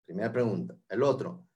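normal talking voice

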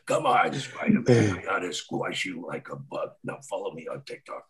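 low menacing villain. Acted. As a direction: threaten like a quiet supervillain. Villain threatening Spider-Man.

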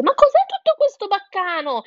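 high, loud indignant hen; startled hen. A narrator's reading. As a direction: burst out demanding an explanation. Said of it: "uscì di colpo dalla porta e disse". (gallina allarmata, voce alta e forte)

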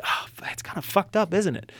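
whispered, dismayed realization. Retold or imitated. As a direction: breathe it out, let it sink in quietly. Realizing the print is ruined.

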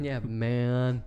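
stoner drawl. Shown as a demonstration. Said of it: "You gotta drawl it out".

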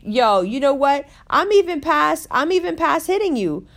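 furious threat. Retold or imitated. [name] telling the groper she'll get her friends, angry and high. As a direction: threaten him, fired up and pitched high. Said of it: "I was like".

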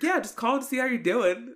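high, loud breezy dad voice; casual dad. Retold or imitated. Voices dad's oblivious casual reply.

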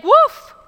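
sharp high yelp of a woof; dog bark. A narrator's reading. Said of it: "interrupted the dog".

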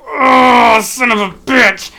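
loud, slow yell of pain; pained shout. Retold or imitated. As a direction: bellow it like he hit his sore toe. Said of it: "all you hear is me go".

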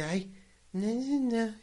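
high whiny nonsense imitation; mocking mimicry. Retelling the moviegoer's retort, mocking mimicry. Mocks the chatty woman's retort.

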